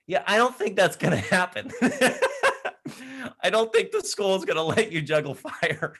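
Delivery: amused, teasing no; laughing it off; laughing refusal. Retold or imitated. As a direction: chuckle while turning him down.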